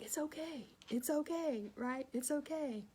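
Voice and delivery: high soothing reassurance; reassuring. Retold or imitated. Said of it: "I'm like".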